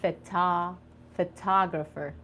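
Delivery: phota- then photographer, softly. Shown as a demonstration. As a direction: coax the student through photographer. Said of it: "look at me".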